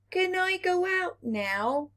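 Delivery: put-on high, begging child's voice; sick Little Earth begging his parents to go out; pleading little planet. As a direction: ask it hopefully, like a sick child.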